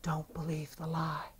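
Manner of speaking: slow, very soft whisper. Retold or imitated. Holy Spirit speaking to a sick man, whispering. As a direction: whisper it slowly, like a secret warning. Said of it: "Holy Spirit said in a whisper".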